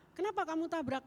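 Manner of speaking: imitating an angry driver, high-pitched; angry demand. Driver confronts the woman after the crash, angrily. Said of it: "orangnya turun marah-marah".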